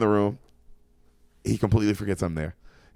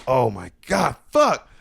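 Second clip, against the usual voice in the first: shocked; 4 dB louder, much slower than usual. Retold or imitated. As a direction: blurt it out in panic. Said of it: "And I'm like".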